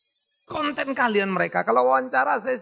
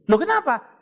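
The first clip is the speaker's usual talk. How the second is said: surprised question; 8 semitones higher, 5 dB louder than usual. Retold or imitated. Doctor explains to patient.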